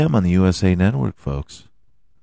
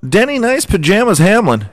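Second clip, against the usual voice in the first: announcer hype; 8 semitones higher, faster than usual. Pretend. Hyped race announcer countdown.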